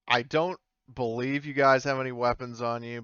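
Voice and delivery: normal talking voice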